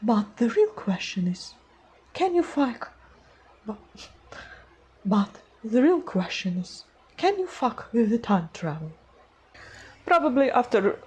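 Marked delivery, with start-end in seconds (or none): 0.0-2.9 put-on queen voiceover, rising high at the end; awkward attempt at a villainess line; Queen of Hearts. Shown as a demonstration. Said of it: "I made my voice higher". 3.7-8.9 put-on villainess voiceover, a bit held back; Queen of Hearts. Shown as a demonstration. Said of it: "I made my voice higher".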